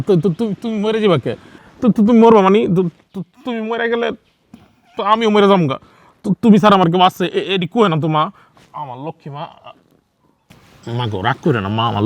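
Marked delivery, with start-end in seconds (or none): 0.1-5.8 high, stammering panic; shocked son blurting in alarm. Acted. Son panicking that his mother speaks of dying, high and stammering.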